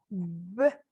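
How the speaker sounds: B as a low, isolated lip sound; bilabial B. Shown as a demonstration. Teacher showing how labial B is pronounced, sound demo. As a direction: press both lips together for B. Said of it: "Porque se pronuncia".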